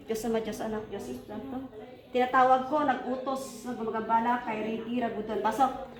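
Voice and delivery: low, soft incantation under the breath; a healer's hushed invocation to drive a spirit out; murmured prayer. Acted. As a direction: pray quietly over the child, steady and hushed. Repeated prayer with corrected name.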